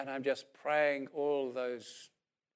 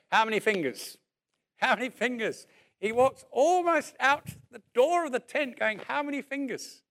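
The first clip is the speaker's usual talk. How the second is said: calling out, climbing higher and louder; testing call; 8 semitones higher, 4 dB louder than usual. Retold or imitated. Preacher testing blind man from afar, calling ever higher. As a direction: keep asking, pushing further each time.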